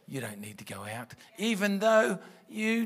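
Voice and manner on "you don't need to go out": low, hushed, quick discouragement; inner thought voice. Acted. Preacher voicing the thought against going for prayer, low and hushed. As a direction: slip it in quickly, like a private thought. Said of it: "it's the thought voice that".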